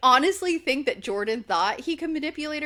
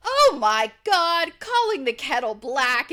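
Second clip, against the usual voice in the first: mock shocked; 4 semitones higher, 5 dB louder than usual. [name] reacting to Marciano's hypocrisy, loud mock shock. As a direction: stretch it out in mock outrage.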